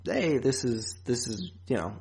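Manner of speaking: normal talking voice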